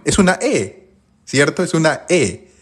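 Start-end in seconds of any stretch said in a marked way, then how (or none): none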